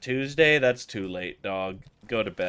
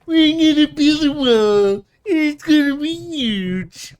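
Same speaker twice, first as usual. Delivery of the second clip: much higher, louder squeaky Trump; 13 semitones higher, 8 dB louder than usual. Pretend. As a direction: squeak out the boast as a silly Trump. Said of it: "I wish he talked like that".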